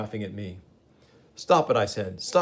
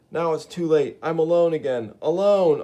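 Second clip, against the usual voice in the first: higher, louder wail of loneliness; grieving cry; anguished lament; 6 semitones higher, 4 dB louder than usual. Acted. Gibbon laments being alone.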